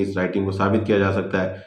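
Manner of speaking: ordinary talk, nothing marked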